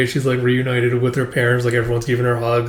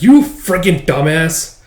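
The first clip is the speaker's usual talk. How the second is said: slow, louder, scornful insult; mad insult; 4 semitones higher, 5 dB louder, slower than usual. Acted. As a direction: spit it at her slowly. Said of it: "And Alma just gets mad".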